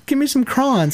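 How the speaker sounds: crons as one syllable, high-pitched; teasing high crons for crayons; mimicked pronunciation. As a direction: say crons to rhyme with ons. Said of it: "It sounds like this".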